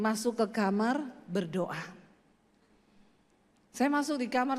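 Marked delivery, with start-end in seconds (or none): 0.0-1.9 lower, soft voice of God. Retold or imitated. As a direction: speak as a calm inner voice. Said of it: "dengar suara Tuhan tuh ngomong gini". (suara Tuhan yang lembut dan rendah)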